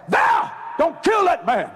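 high, slow pleading shout; calling out. Retold or imitated. Daddy to mama holding the rifle, calling out. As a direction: call her name out high and drawn out. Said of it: "He called her Val".

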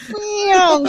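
high imitated rocket zoom through laughter; rocket whoosh noise. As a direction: mimic a jet shooting up like a rocket. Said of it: "like a rocket".

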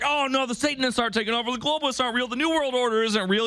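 high, fast dismissive mock voice; mocking denial. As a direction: play the naive denier, scoffing. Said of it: "The entire world's like".

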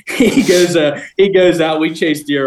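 high, loud laughing through the words; laughing while talking. Real, not acted. Laughing about friend Tim.

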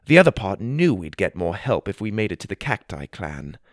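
plain reading voice

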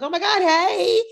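high, loud squeal of recognition; excited greeting. Retold or imitated. Fan spotting reality star in real life, excited greeting.

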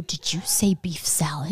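hushed, breathy disbelief; whispered question. Retold or imitated. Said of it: "I go".

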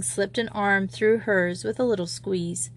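plain reading voice

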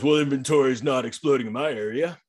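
lightly mocking voice of a doubter; naysayer guy. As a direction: play that skeptical guy, put-on voice. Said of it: "there is gonna be that guy".

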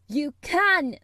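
put-on loud, high angry child's shout; shouted retort. A narrator's reading. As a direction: shout him down. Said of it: "shouted Mary".

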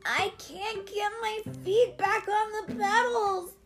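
put-on high-pitched child in alarm; panicked cry. A narrator's reading. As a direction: cry out like a kid losing control. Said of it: "Alex cried".

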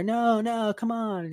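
high imitated pleading; pleading friends. Friends pull drunk woman off machine, high mock pleading. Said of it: "Her friends are, like, grabbing her".

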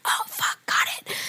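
sudden hushed whisper; whispered realisation. Retold or imitated. Drunk girl learning his secret, whispering back. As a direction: drop to a conspiratorial whisper.

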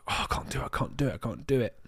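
whispered under the breath, head down, giving up; frustrated whisper. Retold or imitated. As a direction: murmure qu'il n'y arrive pas, tête baissée. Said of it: "and I was like".

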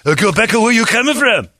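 imitation Korean accent building to a high yell; hammed-up scolding in a Korean accent; mock Korean accent. Man banishing the hillbillies, mock Korean accent. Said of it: "a Korean man with an accent".

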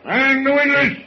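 booming high shout across the deck; bellowed order. Acted. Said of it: "Holy mackerel, what a voice".

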